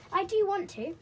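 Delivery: high, loud and eager; little girl pleading excitedly; delighted girl. A narrator's reading. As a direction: beg with delight. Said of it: "cried Molly in delight".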